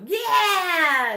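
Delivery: high loud laughing yell; cheering yell. Retold or imitated. Mother mimics husband and daughter teaming up, laughing yell. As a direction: whoop it like a teasing gang. Family ganging up on mom.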